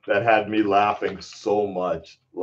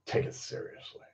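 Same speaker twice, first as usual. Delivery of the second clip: whisper; 18 dB quieter than usual. Real, not acted. Muttering the line to himself.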